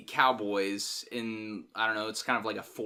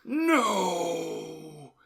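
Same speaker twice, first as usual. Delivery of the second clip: long, higher mock movie-hero yell; retold drawn-out "no"; 6 semitones higher than usual. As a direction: stretch out an imitated defiant cry. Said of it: "he's just like".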